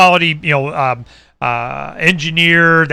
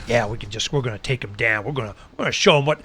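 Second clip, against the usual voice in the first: low, much softer scheming murmur; conspiring cops; 6 semitones lower, 9 dB quieter, faster than usual. Retold or imitated. Cops scheming behind him, soft. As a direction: plot it under your breath. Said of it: "building themselves up and threatening".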